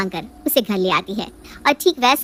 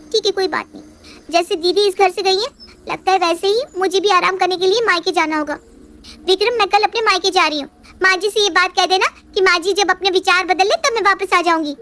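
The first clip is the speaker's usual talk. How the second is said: put-on higher young wife, complaining; sulky daughter-in-law pleading her case; higher-pitched aggrieved young wife; Riya's voice; 8 semitones higher than usual. Riya announces leaving.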